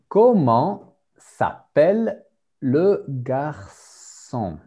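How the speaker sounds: slow, carefully spaced question; slow teacher model. Shown as a demonstration. A teacher slowly models the question for the class, carefully spaced. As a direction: model the question slowly so students can copy it. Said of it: "teacher models question slowly".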